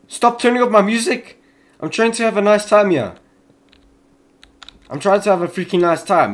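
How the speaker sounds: annoyed complaint. Real, not acted. Gamer scolding the game over loud music, annoyed. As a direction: tell the game off, exasperated.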